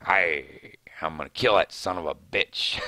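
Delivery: low, growled Southern pirate voice; Daryl Dixon pirate voice. Retold or imitated. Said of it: "That's my pirate voice".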